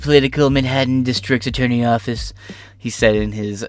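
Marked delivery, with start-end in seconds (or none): 0.0-2.3 imitating Trump.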